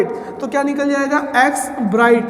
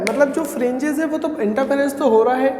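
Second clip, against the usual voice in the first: ordinary talk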